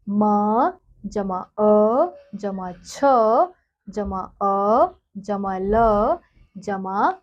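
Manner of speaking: stretched letter by letter; slow spelling out. Shown as a demonstration. Teacher sounding out a word for children, very slow. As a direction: build the word slowly, one sound at a time. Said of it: "ऐसे ही अगला है".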